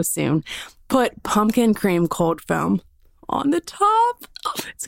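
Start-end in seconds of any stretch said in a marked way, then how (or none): 3.3-4.9 excited hushed whisper; breathless whispered tease about a new drink. Real, not acted. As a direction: whisper it like a delicious secret. Excited secret about fall drink.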